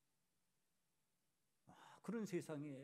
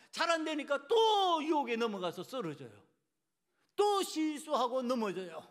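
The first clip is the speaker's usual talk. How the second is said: loud, raised preaching voice; impassioned preaching; 8 semitones higher, 12 dB louder than usual. Real, not acted. Preacher urging the congregation to grieve and pray, impassioned. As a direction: drive it home to the congregation with long, stretched key words.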